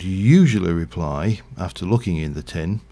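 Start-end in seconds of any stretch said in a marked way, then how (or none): none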